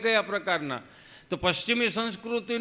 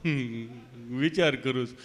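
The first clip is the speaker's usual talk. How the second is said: lower, halting stammering friend; Gabho, tongue-tied; 6 semitones lower, 3 dB quieter than usual. Retold or imitated. Friend answering the nurse at vaccination, lower halting stammer. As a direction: drag the words out like a tongue stuck in place. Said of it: "જીભ જલાય સોંટે અટકે".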